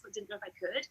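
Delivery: whispered, shy admission. Retold or imitated. Shy man confesses he wanted burlesque classes, whispered. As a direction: whisper it as if embarrassed to ask.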